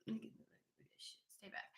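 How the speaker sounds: slow breathy whisper to a spirit; whispering at an unseen spirit to keep away; whispered warning. Pretend. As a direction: hush it out, slow and secret. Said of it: "Spirit, you just pissing me off".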